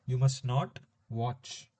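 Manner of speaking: slow dictation. Shown as a demonstration. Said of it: "if I'm conjugating must".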